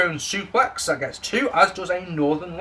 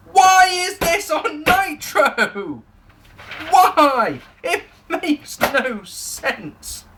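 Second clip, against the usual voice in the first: high, drawn-out cry of disbelief; hammed-up exasperated howl; exasperated wail; 12 semitones higher, 4 dB louder, much slower than usual. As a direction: wail it slowly, pitched way up. Exasperated rant about the programme.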